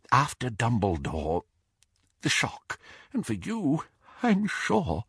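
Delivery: put-on hushed, breathy old man's whisper. A narrator's reading. Old Doge confides in Harry, whispering. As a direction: let it drop to a near whisper. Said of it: "he whispered".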